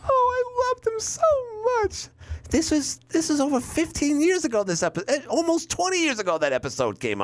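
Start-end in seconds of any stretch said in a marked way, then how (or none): 0.1-2.1 very high, mocking fake-sob falsetto; a sister's overacted lament, voiced far higher; mock grieving Janice. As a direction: ham up her phony grief. Acting Janice's fake grief.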